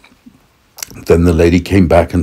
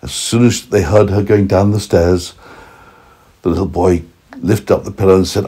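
ordinary delivery, nothing marked

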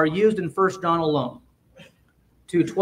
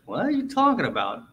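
offended retort; 3 dB quieter than usual. Retold or imitated. Church member to preacher, mock offended at being called little children. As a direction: play the insulted listener, puzzled and put out. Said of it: "yeah, you'd be like".

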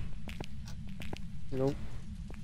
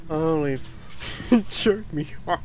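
words broken up by laughter; laughing hard; 8 semitones higher, slower than usual. Real, not acted. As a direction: struggle to speak through real laughter. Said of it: "I'm laughing so hard".

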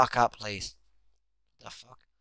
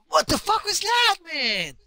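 high, loud shout of disbelief; shocked yell; 7 dB louder, slower than usual. Real, not acted. Player yelling at a bizarre play, high shocked shout. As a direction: yelp it out in outrage.

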